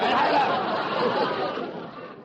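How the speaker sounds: short breathy laugh. Real, not acted. Straight man laughs at the straight-man jab.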